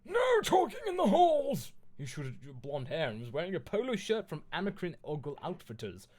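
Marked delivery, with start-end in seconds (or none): 0.0-1.7 very high, loud scolding yell; shrieking telling-off; angry shouting. Acted. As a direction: screech the order furiously. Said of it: "started shredding at us angrily".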